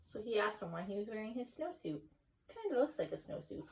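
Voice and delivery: quiet aside. Real, not acted. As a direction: drop the voice for a side note.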